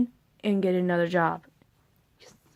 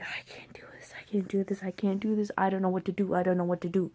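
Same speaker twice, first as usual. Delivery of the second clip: whispered, softer, hopeless; despairing whisper; 7 dB quieter, faster than usual. Retold or imitated. Reliving the breakdown to family, whispered. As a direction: breathe out the despair. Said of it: "I'm like".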